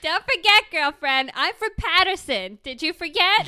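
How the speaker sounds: loud, high mock threat; acted threat. Playing Teresa, yelling at Danielle in a loud threat. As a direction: shout it like a reality TV diva.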